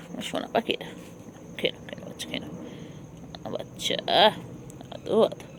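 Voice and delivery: soft whispered endearments; whispering. Real, not acted. To her eating cat, soft whispered endearments. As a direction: whisper lovingly while the cat eats.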